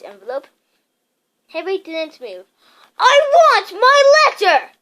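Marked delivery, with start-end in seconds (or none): 3.0-4.7 high, loud slow shout. A narrator's reading. Harry shouts at Uncle Vernon for his letter. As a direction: yell for your letter. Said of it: "he shouted".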